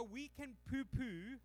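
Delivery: ordinary delivery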